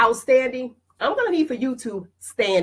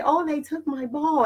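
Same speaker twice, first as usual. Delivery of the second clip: timid whine; 6 semitones higher, 4 dB quieter than usual. Retold or imitated. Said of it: "You wanna be timid and say".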